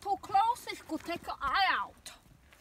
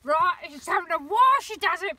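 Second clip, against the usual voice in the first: louder, fed-up complaint; exasperated, raised voice; irritated; 8 dB louder than usual. Real, not acted. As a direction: vent a month of irritation. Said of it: "it's irritated me for a month".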